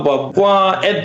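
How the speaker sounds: ordinary talk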